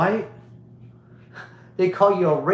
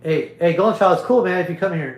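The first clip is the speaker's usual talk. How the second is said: calling out; 3 semitones higher, 6 dB louder, faster than usual. Real, not acted. Calling out to someone nearby.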